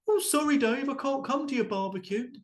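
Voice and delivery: high, prissy declining voice; gluten-free friend. Retold or imitated. Friend refusing Dave's barbecue invite, high and fussy. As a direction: turn down the barbecue with fussy regret. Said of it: "Everyone was worried about that".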